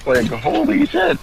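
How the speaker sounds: high, excited exclamation; amazed. Real, not acted. Fan praising the show's writing, high and amazed. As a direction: burst out, really impressed.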